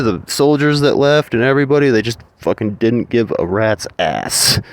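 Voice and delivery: normal talking voice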